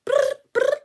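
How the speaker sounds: high imitated ring; ringing phone.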